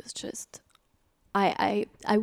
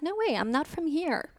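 retold refusal; 6 semitones higher than usual. Retold refusal to fellow prisoners.

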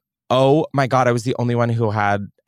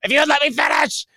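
mock indignant; 13 semitones higher than usual. Real, not acted. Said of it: "cut off, defending".

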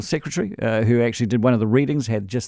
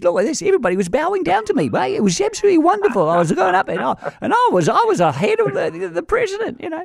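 much higher, fast, swaggering London street voice; Ali G voice. Retold or imitated. Mimicking the Lord Mayor bragging to his radio co-host, in a high, fast Ali G voice. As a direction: brag it up like a cocky London lad. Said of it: "who talks like Ali G. He talks like this as well".

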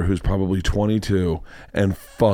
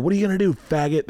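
pitched-up, lightly acted jeer; taunting kids' yell; 6 semitones higher, faster than usual. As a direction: imitate kids jeering over a fence. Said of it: "They keep yelling that".

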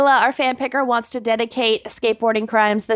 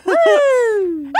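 excited cheer; 13 semitones higher, 4 dB louder than usual. Real, not acted. Cheering after introductions.

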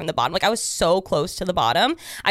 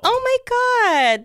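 high, slow, stretched out oh my God; dramatic gasp; 5 dB louder, much slower than usual. Real, not acted. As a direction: drag it out in shock. Gasps at the memory.

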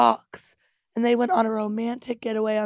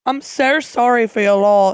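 put-on Australian accent; 7 dB louder than usual. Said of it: "they did lots of Aussie comments".

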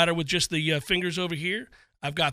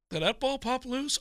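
soft, higher wishful question; imitated hopefulness; 4 semitones higher, 7 dB quieter than usual. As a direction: mimic a hopeful guy, hushed. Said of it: "That hopefulness in a guy's voice".

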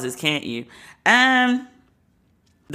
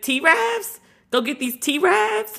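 mocking disbelief; 9 semitones higher, much slower than usual. Mocking the host's slang word.

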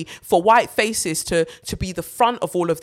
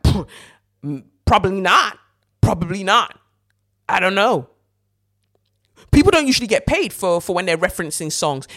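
mocking [name]; 2 semitones higher, 5 dB louder than usual. Mocking [name]'s shrug.

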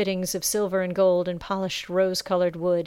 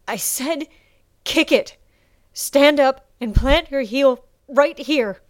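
loud, high, slow insistence; insistent shout; 7 semitones higher, 7 dB louder, slower than usual. A narrator's reading. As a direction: spell it out through the pain. Sasha insisting through pain.

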